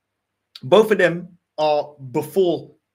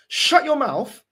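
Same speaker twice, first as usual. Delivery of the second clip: angry shout; 8 semitones higher, slower than usual. Real, not acted. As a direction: bark it at him. Ranting fan insulting manager.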